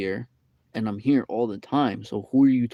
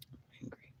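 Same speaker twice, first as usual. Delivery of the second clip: whispered angry; 27 dB quieter, faster than usual. Shown as a demonstration. Repeating his anger in a whisper to himself. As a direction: whisper the words I'm angry.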